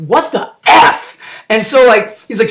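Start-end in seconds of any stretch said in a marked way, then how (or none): 0.1-1.2 slow stretched shocked question; drawn-out what the F. Retold or imitated. Chelios after the crash, slow stunned question. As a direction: drag it out in disbelief.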